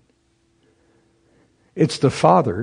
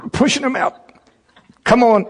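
mock straining tree; 11 semitones higher than usual. Tree voiced straining to push.

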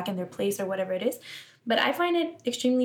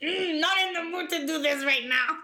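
dismissive quote; 8 semitones higher than usual. Retold or imitated. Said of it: "And I'm like".

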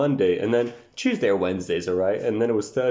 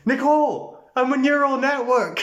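high, loud mocking voice; mock him in a squeaky nerd voice; robotic nerd impression; 4 dB louder than usual. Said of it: "and the quote is".

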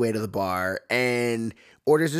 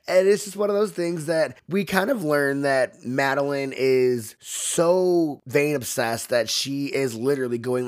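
ordinary delivery

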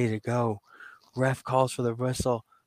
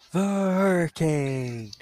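higher, slow ring announcer stretch; drawn-out announcer call; 5 semitones higher, much slower than usual. Pretend. Ring announcer presenting the winner, drawn out. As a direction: stretch the name out like announcing a champion.

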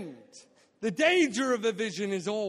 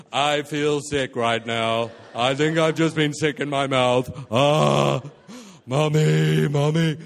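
lower, louder, slow queasy whimper, calling for mommy; mock nauseous wail; sick with nerves; 6 semitones lower, 9 dB louder, slower than usual. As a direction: ham up feeling sick and wanting mommy. Nervous, feeling sick on TV.

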